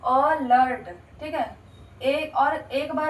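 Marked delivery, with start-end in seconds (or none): 0.0-0.9 "अलर्ट" begun with a short uh; short uh start. Shown as a demonstration. Teacher modelling "अलर्ट" for students. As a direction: open the word with uh. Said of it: "अ का हमारा क्या बनेगा".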